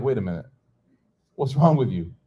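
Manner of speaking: ordinary delivery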